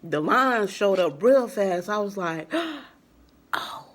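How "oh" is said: sharp gasp, then a hushed "oh my God"; shocked gasp. Retold or imitated. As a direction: gasp and barely get the words out in shock. Acts out seeing positive test.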